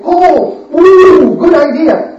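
loud eager ooh at a good idea; light up with a sudden idea; mock delighted gasp; excited realization. They welcome the job idea.

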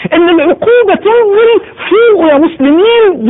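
high, slow and tearful; weeping plea. Real, not acted. Preacher begging Muslims to wake up, weeping and slow. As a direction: cry out to wake the listeners.

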